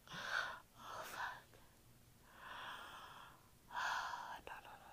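whispered curse then pleading nos; hushed, barely voiced; whispered distress. Real, not acted. Sick, groaning in the dark.

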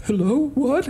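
put-on high-pitched confused old lady; elderly lady. Elderly lady answering a prank call, high and puzzled. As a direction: imitate an old woman answering the phone. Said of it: "this elderly lady w-, answered the phone".

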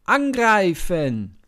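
very loud, high, slow yelled order; battle cry. Acted. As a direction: scream the charge. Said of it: "ruft Abner".

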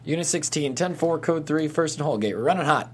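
high, loud urgent radio reply. Acted. Mark answering dispatch on the radio, loud and urgent.

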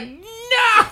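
high, loud laughing yelp of protest; panicked no. Retold or imitated. Teen begging the lady not to fetch family, yelping. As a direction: yelp it as if stopping her.

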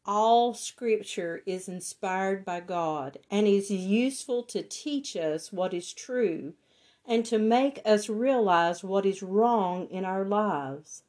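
ordinary talk, nothing marked

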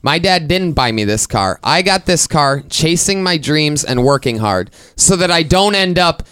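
mock indignant speech; self-righteous rant. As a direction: build to a proud climax.

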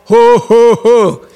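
hammed-up giant's scoffing laughter; mock giant laugh. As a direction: imitate Goliath's scornful laugh. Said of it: "would have looked at this and just laughed".

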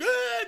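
high mock scream of fright; scared scream. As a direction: yelp like falling off a cliff. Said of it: "you guys would be like".

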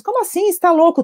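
put-on children shocked at their father; shocked kids. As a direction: squeal like startled children. Children react to father's outburst.

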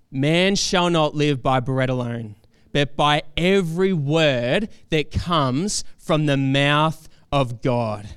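slow, weighty declaration; emphatic Scripture reading. Acted. Preacher voicing Jesus answering Satan, slow emphatic declaration. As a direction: proclaim it firmly, stretching the phrases.

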